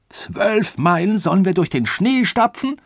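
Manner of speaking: loud, high indignant outburst; outraged. A narrator's reading. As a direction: protest in outrage, voice shooting up. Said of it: "zeigte sich empört". (empörter, lauter Ausruf)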